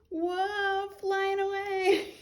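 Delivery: high, drawn-out whoa of wonder; mock-astonished, pitched high for kids; playful amazement. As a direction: play-act amazement, stretching it slowly. Pretending children are flying off.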